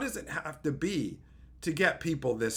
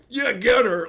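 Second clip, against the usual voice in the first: mock cheering; 4 semitones higher, 8 dB louder than usual. Mocking cheering viewers.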